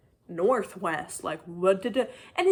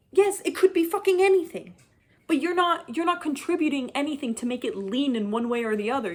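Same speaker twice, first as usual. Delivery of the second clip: louder, much higher outburst of frustration; exasperated rant; 6 semitones higher, 5 dB louder than usual. Real, not acted. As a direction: blow up at the author's vagueness. Ranting at the book's author.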